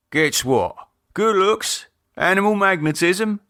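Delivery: teasing, fishing for compliments; preening joke about himself; mock vain. Keith teasing, voiced.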